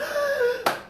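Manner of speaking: mock gasp of alarm; sharp intake of breath, imitating mom; shocked gasp.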